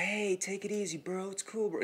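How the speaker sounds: high, soft, patronizing calm-down voice; dickish fake soothing. Dog owner telling the homeowner to relax, patronizing and high. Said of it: "he was doing it in such a dick way".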